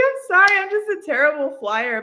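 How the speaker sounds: high, loud, teary sorry; crying apology. Retold or imitated. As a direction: apologize while still crying. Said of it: "I, I was crying".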